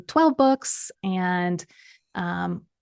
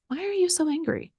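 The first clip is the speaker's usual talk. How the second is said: gentle, softer question with a patient tone; calm patient voice; 8 semitones higher, 5 dB quieter than usual. Retold or imitated. God gently asking the angry driver, calm and patient. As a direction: ask it calmly, with no blame. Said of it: "I hear this very calm, patient voice say".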